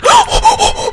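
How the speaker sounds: loud, high frightened gasps; shrieky panicked gasping; startled gasps. Real, not acted. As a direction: gasp sharply at a jump scare. Jump scare.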